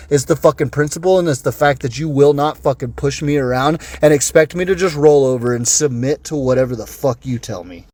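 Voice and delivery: ordinary talk, nothing marked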